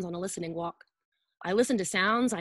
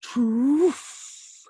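loud rising "Troof"; bus engine start; 3 semitones higher, 6 dB louder than usual. Shown as a demonstration. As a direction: blast "Troof" like a bus pulling off. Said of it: "The bus starts up again".

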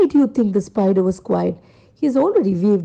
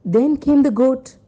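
plain reading voice

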